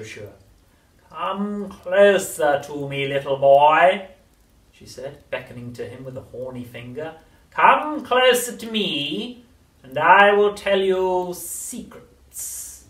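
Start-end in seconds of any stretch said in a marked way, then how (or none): 1.1-4.1 high, very slow, coaxing crone; luring witch voice. A narrator's reading. Grandma luring. 7.5-12.8 high, very slow, coaxing crone; luring witch voice. A narrator's reading. Grandma luring.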